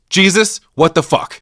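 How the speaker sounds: loud, high-pitched mock exasperation; pissed-off outburst. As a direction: act out an angry outburst at Jesus. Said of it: "and she's pissed".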